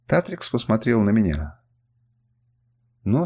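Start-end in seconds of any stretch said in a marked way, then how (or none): none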